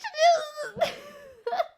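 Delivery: loud, high burst of laughter; high shrieking laugh. Real, not acted. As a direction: crack up at your own joke. Bursts out laughing at own joke.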